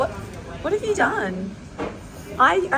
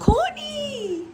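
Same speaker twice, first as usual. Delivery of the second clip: calling out; 13 semitones higher than usual. Real, not acted. Calling out to friend.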